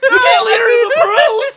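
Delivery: high, loud, crying-laughing protest; laughing outcry. Real, not acted. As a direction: yelp it through laughter. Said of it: "exasperated laughing outcry".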